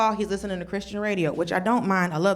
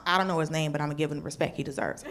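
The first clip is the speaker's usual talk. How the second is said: normal talking voice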